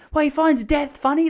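high, loud panicked wail; mock panic. Panicking boy protesting about the laughing kid, mock panic.

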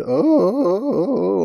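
high, drawn-out moan.

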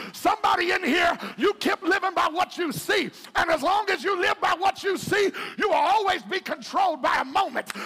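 loud, high, shouted preaching over music; shouted sermon climax; full-force preaching. Acted. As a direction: preach it hard and climb.

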